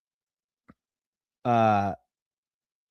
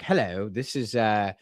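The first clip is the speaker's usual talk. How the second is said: put-on British official; British accent. Streamer imagining a call from a Starmer aide, British accent. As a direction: try out a British prank-caller voice. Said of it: "like, a British guy".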